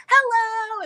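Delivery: high, loud, hammed-up child greeting; playful little-kid voice; imitating show-off toddler. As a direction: put on a big show as a cute toddler. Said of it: "Kennedy put on a show".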